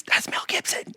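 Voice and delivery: soft, voiceless stage whisper; mouthed whisper. Retold or imitated. Answering his friend across the lobby, secretive whisper. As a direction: imitate mouthing it to a friend far away. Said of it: "mouths to me".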